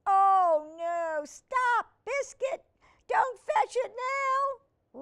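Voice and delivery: very high, loud, hammed-up panic; alarmed cry. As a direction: shriek at the puppy to stop. Girl panics as puppy runs.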